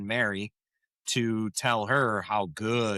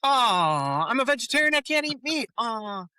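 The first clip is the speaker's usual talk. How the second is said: mock sympathy; 11 semitones higher than usual. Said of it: "And she said".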